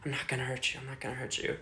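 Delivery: imitating him winding down, low and very soft; subdued, fading reassurance to himself; calming down. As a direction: repeat it low, under your breath. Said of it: "slow down, to, like".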